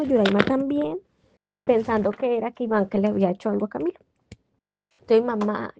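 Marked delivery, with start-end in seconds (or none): none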